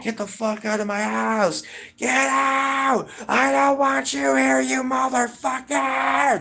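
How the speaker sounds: very high, drawn-out imitated scream; screaming grandpa. Grandson imitates grandpa ordering him out of the house, screaming. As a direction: scream it like a raging grandpa, stretching the last words. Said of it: "starts screaming at me saying".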